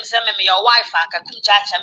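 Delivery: normal talking voice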